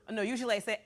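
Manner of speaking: ordinary delivery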